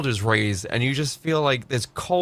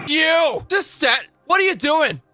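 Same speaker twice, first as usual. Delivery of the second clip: high, loud mock yell; mock cop yell; 11 semitones higher, 4 dB louder than usual. Streamer voicing the female cops, loud mock yell. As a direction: bark at him like an angry cop. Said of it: "and they went".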